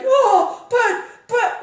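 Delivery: high, loud panicked stammer; mock fear. Driver panics at the fast turn, high frightened stammer. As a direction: squeal it like you're losing your nerve.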